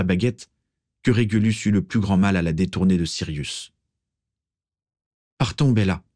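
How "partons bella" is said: understated entreaty; pleading. A narrator's reading. Régulus takes Bellatrix by the shoulders, mild pleading. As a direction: beg her gently to leave. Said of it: "supplia Régulus".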